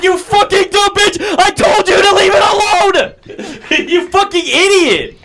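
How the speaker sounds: high-pitched, hammed-up furious shout; exasperated yelling. Brother berating his sister over the call, high shouting. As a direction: yell it in exaggerated exasperation.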